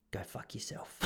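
very soft, almost whispered brush-off; hushed insult. Retold or imitated. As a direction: drop the insult under your breath. Deadpan quiet insult as punchline.

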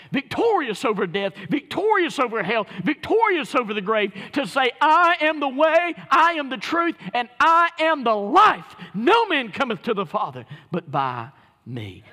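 high, loud triumphant proclamation; full-force preaching. Acted. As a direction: cry out each phrase like a victory shout from the pulpit.

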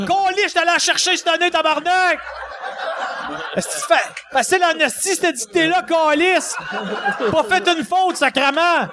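high, hammed-up cursing tirade; angry swearing rant. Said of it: "il y a ce discours-là".

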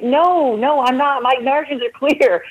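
high, emphatic no to the oncologist; retold refusal. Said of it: "I'm like".

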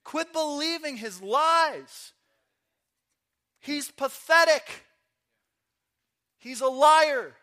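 loud, high, drawn-out declaration; forceful pulpit shout; emphatic preacher shout. Real, not acted. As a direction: belt it out slowly, punching each phrase.

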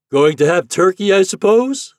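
high, loud shouted question; hearty hail with a rising end; captain calling. A narrator's reading. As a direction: holler a question across the river. Captain calling across the water.

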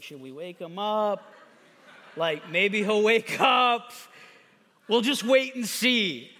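drawn-out, casual dithering; mock wondering. Disciples standing over sleeping Jesus, mock hesitant wondering. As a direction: playfully imitate people unsure what to do. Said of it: "this was not a, everybody standing over Jesus".

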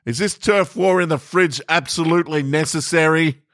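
mock-indignant call down the hall. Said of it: "so I yelled".

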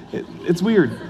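usual speaking voice

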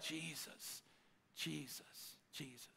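near-silent, falling whisper of the name; hushed prayer. Retold or imitated. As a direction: breathe the name out, barely audible, overwhelmed. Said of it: "I'll be like".

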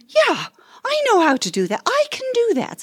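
rising delight, as if the answer is obvious; excited realisation. Retold or imitated. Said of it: "And I thought".

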